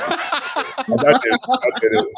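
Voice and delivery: high, slow laughing admission; laughing confession. Real, not acted. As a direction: own it with a laugh.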